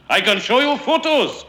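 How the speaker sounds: high, loud indignant insistence; heated protest. A narrator's reading. Stranger insists to Enoch Davis, high heated protest. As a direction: protest hotly at high pitch. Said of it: "the stranger said in a fury".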